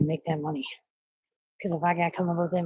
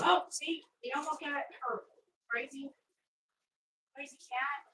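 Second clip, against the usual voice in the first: soft, higher pet talk; 6 semitones higher, 5 dB quieter than usual. Real, not acted. Owner fussing over her cat Link: soft, higher pet talk. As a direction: coo at the cat gently.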